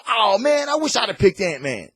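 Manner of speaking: put-on high, loud envious kid whine. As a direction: kids regretting their pick. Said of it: "they're gonna go".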